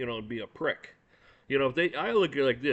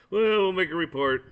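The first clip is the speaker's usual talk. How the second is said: mock dismissive cop; 5 semitones higher, 6 dB louder than usual. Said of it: "the police won't even look for 'em".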